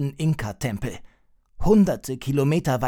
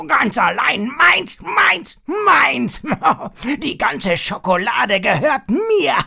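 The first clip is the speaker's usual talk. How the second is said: high, loud, slow greedy villain bellow; roaring while chewing; 12 semitones higher, 7 dB louder, slower than usual. Acted. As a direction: bellow it with a mouth full of chocolate. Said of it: "brüllte er schmatzend".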